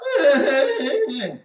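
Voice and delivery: loud, high fake wailing; mock crying. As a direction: bawl mockingly in a high voice. Mock crying between insults.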